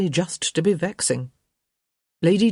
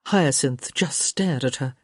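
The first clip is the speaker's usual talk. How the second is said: plain reading voice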